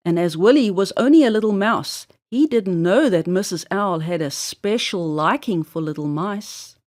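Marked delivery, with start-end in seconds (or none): none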